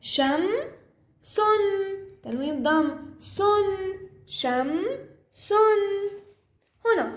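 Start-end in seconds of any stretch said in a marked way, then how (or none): 0.0-1.6 شم, pause, then a higher سن; syllable by syllable. Shown as a demonstration. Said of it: "بحاول أقرأها أنا دمج". 3.2-5.8 sing-song sounding out of سن and شم; syllable by syllable. Shown as a demonstration. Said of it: "بحاول أقرأها أنا دمج".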